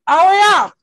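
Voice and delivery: loud, high oh yeah; sudden recall. Real, not acted. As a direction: blurt it out as it comes back. Sudden remembering.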